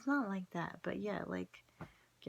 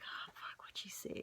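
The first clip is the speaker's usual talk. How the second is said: whispered, much softer, worried; panicked inner whisper; 9 dB quieter than usual. Retold or imitated. Herself thinking while the psychic stares, whispered panic. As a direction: hiss the worry under your breath.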